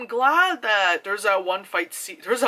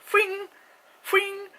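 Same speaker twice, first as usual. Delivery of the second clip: mock whoosh; 13 semitones higher than usual. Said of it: "she's like".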